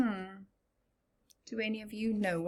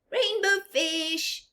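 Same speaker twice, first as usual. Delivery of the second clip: higher, slow eager call; little fish calling; 8 semitones higher, 4 dB louder, slower than usual. Acted. Little blue fish calling after Rainbow Fish, eager. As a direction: call out hopefully. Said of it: "he called".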